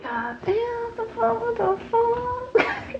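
very high, slow, squeaky disbelief; stunned inner voice. Retold or imitated. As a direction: stretch it out high like a cartoon double take. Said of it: "in my mind".

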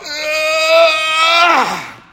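long, very high scream; drawn-out high wail; yell of labour pain. Acted. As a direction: scream out in agony, sustained. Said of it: "she yells out".

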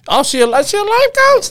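squeaky high mock surprise; voice went high. Host mimicking co-host's reaction to life coach, voice high. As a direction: shoot way up high, imitating someone's disbelief. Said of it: "That when your voice went high".

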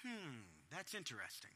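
soft, low, unbothered hmm; composed parent listening; calm listening. Shown as a demonstration. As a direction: play it cool and curious. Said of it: "you see me do this".

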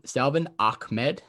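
"Ahmed" with a hard K sound in the middle. Real, not acted. Host announcing the next player, "Ahmed" pronounced with a K. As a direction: say "Ahmed" as Ak-med, K clearly sounded. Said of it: "No, too much K".

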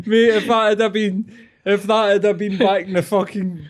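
half-laughing telling-off; amused disbelief; laughing outrage. Real, not acted. Laughing mock alarm.

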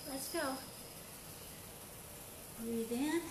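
ordinary talk

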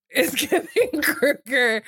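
laughing through the words, drawn out. Real, not acted. Co-host echoing the Freddy Krueger joke, slow and laughing. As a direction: draw it out slowly through giggles.

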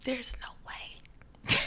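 slow unvoiced whisper. Real, not acted. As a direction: hush it, gossiping. Whispered gossip.

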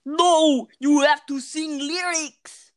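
loud, drawn-out protest; emphatic insistence. Real, not acted. Refusing to let a friend just play the video, loud. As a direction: insist loudly, stretching the words.

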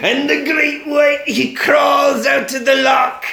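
high, drawn-out Scottish storytelling; hammed-up Scottish islander, stretching the words out; mock Scottish. Islander spinning a tale of haggis-eating sharks, mock Scottish.

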